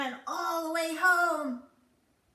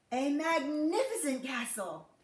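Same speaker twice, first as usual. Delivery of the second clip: usual speaking voice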